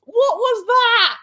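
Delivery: loud, high, drawn-out exclamation; stunned disbelief. Real, not acted. Incredulous reaction right after the game.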